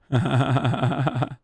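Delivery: quick staccato chuckle; a short run of rapid ha-ha laughter; laughing. Real, not acted. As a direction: laugh at your own silly joke. Laughing at pet the chud joke.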